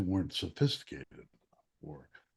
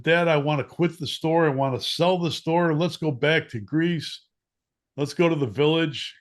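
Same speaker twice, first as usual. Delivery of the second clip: higher, louder, begging his father; pleading kid; 6 semitones higher, 6 dB louder than usual. Retold or imitated. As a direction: plead eagerly to sell up and go home. Kid begging dad to quit.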